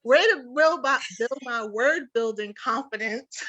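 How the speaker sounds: high, laughing mock thanks; laughing sarcasm. Real, not acted. Laughing mock thanks after being teased.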